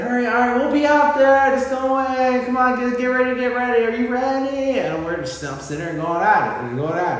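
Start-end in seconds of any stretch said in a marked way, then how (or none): 0.0-4.8 high, louder hurried urging; hurried wife. Retold or imitated. Wife hurrying kids away, high and urgent. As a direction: rush the kids along, much higher. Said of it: "she say".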